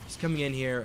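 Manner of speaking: usual speaking voice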